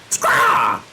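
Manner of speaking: shouted bird screech; loud mock bird cry; bird squawk. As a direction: let out a raucous squawk. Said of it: "I'm not doing the squawks".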